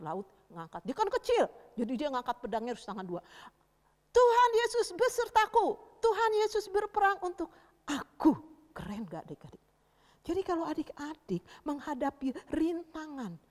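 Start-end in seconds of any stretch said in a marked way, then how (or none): 4.1-8.3 high, loud victory declaration; triumphant cry. Acted. Little David lifting the heavy sword, triumphant shout. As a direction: proclaim it with sword held high.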